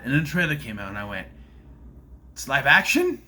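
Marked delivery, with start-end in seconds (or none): 2.3-3.2 loud, rising disbelief; higher, louder, thrown-up question; incredulous. Retold or imitated. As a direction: blurt it as a baffled question. Retold reaction to the trailer.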